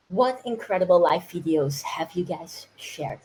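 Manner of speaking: normal talking voice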